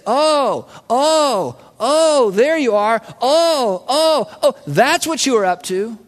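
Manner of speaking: drawn-out, rising-and-falling ohs of discovery; dawning realization. Acted. Believers looking back on history, acted dawning realization. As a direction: let it dawn on you, oh after oh.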